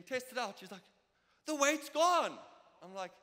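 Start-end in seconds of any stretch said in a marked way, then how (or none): 1.4-2.4 waitress's high, loud amazed cry; surprised exclaim. Retold or imitated. Waitress at the restaurant, amazed her back pain is gone, high loud exclaim. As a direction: blurt it out in amazement.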